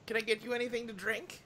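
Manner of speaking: quick, soft-spoken grandma; old lady voice. Acted. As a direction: offer a drink like a doting granny. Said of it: "This is my old lady voice".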